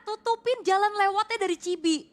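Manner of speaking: high, excited praise; excited commentary. Real, not acted. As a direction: hype up the team play. Hyped praise of team play.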